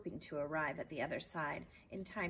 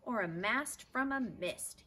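louder, slow yell; 6 semitones higher, 5 dB louder than usual. Acted. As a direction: drag out a loud yell to cap the game. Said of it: "yelled the man".

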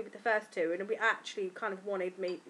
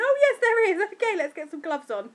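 much higher, louder surprised exclaim; surprised midwife; 10 semitones higher, 11 dB louder than usual. Retold or imitated. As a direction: midwife suddenly sees the head. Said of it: "she went".

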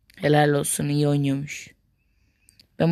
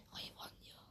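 whispering; 32 dB quieter than usual. Real, not acted. Whispered insult.

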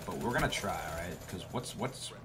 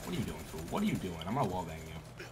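normal talking voice

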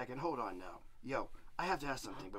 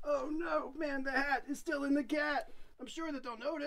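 much higher alarmed voice; panicky; 10 semitones higher than usual. Acted. Devil panicking parents are home, high. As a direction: fret at the news.

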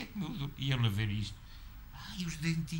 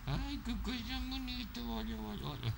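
cooing at a kitten; 9 semitones higher, 4 dB quieter than usual. Real, not acted. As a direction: coo tenderly at the little cat.

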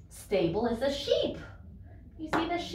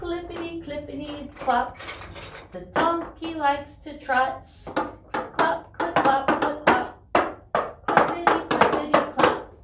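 clip-clop hooves; 4 semitones higher, 4 dB louder than usual. Shown as a demonstration. Imitating trotting hooves.

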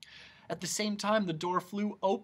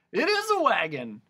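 high, loud confirmation; excited agreement; 7 semitones higher, 6 dB louder than usual. A narrator's reading. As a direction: chime in loudly and high. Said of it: "seconded Tok".

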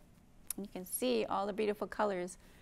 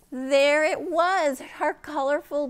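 gasp, then louder and higher; excited discovery; 7 semitones higher, 12 dB louder, slower than usual. Acted. Teacher finding the butterfly in the story, excited. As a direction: burst out with delight at the find.

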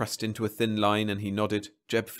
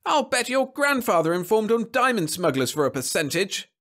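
high, loud angry tirade; yelling; 12 semitones higher, 5 dB louder than usual. A narrator's reading. Caught man accusing the monitor, yelling. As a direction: hurl the accusation at high pitch. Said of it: "yelled the man".

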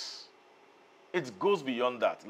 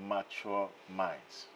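"mature minds" slow and soft, each word set apart; "mature minds" stressed by slowing down and dropping low; emphasis on MATURE MINDS; 4 semitones lower, 9 dB quieter, slower than usual. Acted. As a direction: weigh out "mature minds" slowly after a pause. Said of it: "lay emphasis on the words".

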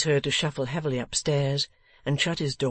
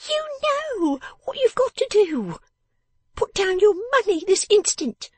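very high, loud, scolding little voice; bossy person of the house; 6 dB louder than usual. Acted. Miss Wren orders her father to hand over money, bossy and high-pitched. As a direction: order him about in a piercingly high voice.

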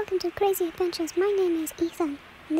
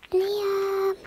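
calling out; 3 semitones higher than usual. Real, not acted. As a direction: call his name across distance. Calling out for friend.

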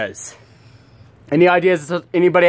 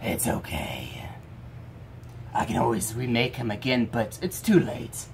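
quiet and low; 5 semitones lower, 11 dB quieter than usual. Acted. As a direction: sink into a defeated hush. Villain answering softly.

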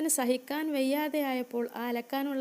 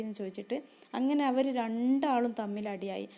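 ordinary delivery, nothing marked

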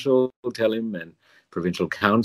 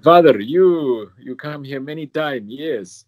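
imitated parish priest's foreign accent, higher pitch; slow, drawn-out opening in a mimicked foreign accent; Father Marinko's accent; 5 semitones higher, 4 dB louder, slower than usual. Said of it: "I shouldn't do the accent".

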